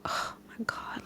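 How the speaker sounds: breathless whisper of dismay; whispered oh my God. Retold or imitated. Whispering to herself after hearing the only date. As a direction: whisper it to herself, stunned the slot is so late. Shocked at the short notice.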